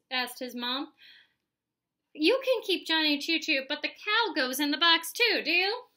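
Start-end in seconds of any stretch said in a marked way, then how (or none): 2.1-5.9 loud, insistent offer; forceful bargaining. A narrator's reading. Mom bargains over the toy box, loud and insistent. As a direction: push the deal, rising on the last word.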